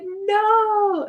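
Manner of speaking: high, drawn-out cry of dismay. Retold or imitated. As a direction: relive a fan's long wail of protest. Retold dismay watching the match.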